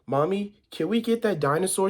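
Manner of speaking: put-on high-pitched child's request; little girl asking. A narrator's reading. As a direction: ask like a little girl in a storybook. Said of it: "George saw a little girl".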